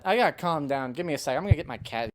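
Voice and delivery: ordinary talk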